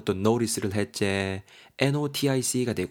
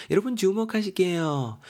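exaggerated attention call; 9 semitones higher than usual. Teacher calls listeners to look at the word, exaggerated high call. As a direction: call everyone to attention in a much higher voice.

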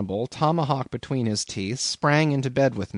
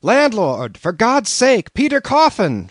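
high, loud panicked shout for help; 11 semitones higher, 7 dB louder, slower than usual. A narrator's reading. Narrator shouting for the landlord in panic. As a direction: scream for the landlord in terror. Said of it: "shouted I".

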